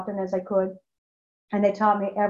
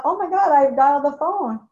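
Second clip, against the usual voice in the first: higher and louder sudden alarm; retold gasp of surprise; startled realization; 5 semitones higher, 6 dB louder than usual. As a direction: catch yourself in shock. Realizing she dialed the phone.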